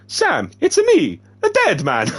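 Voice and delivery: very high, slow, put-on character voice; Dead Man voice. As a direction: stretch out the greeting in a high put-on voice. Dead Man greets Sam.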